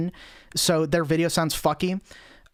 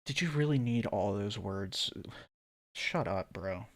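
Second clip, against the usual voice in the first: muttering; 8 semitones lower, 11 dB quieter than usual. Real, not acted. Muttered aside at the essay.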